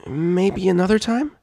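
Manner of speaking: high, sheepish filly voice; a hint of a nervous filly; nervous mutter. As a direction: mutter it, embarrassed. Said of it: "and muttered".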